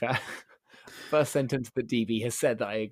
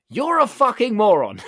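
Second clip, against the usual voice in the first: slow, far higher, much louder yell; shouted insult; 10 semitones higher, 13 dB louder than usual. Retold or imitated. Customer screaming an insult in the man's face, slow loud yell. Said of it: "shouted in my face".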